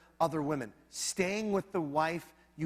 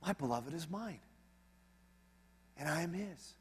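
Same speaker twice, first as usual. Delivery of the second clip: tender reading; 7 dB quieter than usual. Acted. Reading the tender verse softly.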